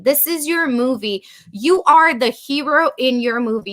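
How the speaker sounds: normal talking voice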